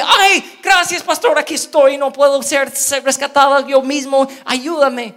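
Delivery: high, loud, desperate cry for help; pleading lost sheep. Acted. Lost sheep begging the shepherd, high desperate loud cry.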